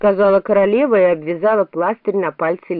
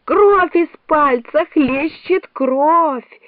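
high, slow, dramatic wail; crying out; 9 semitones higher, 3 dB louder, much slower than usual. A narrator's reading. The Queen shrieks about her bleeding finger, high wailing cry. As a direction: cry it out, stretching every word. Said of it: "закричала королева".

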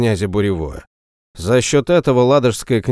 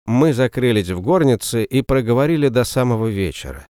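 usual speaking voice

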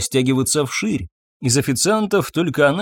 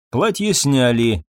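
usual speaking voice

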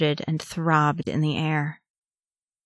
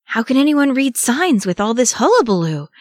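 high, loud, flustered gasp; 7 semitones higher, 5 dB louder than usual. A narrator's reading. Sam protests amid the fleeing and fighting, gasping. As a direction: gasp it out over the racket. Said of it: "Sam gasped".

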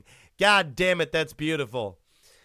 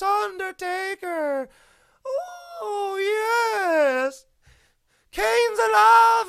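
very high, slow, wailing cry; Paul Bearer impression; 7 dB louder, much slower than usual. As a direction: stretch it out in a high shriek. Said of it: "Paul Bearer".

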